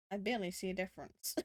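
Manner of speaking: ordinary delivery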